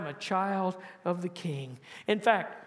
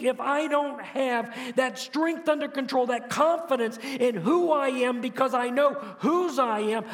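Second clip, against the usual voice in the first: preaching; 4 semitones higher than usual. Acted. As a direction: press the congregation harder. Pastor presses the point.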